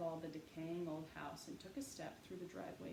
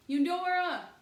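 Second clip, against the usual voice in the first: high, very loud call into the empty yard; boy calling his dog loudly; 12 semitones higher, 17 dB louder than usual. A narrator's reading. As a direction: call the lost dog from the gate. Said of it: "House called".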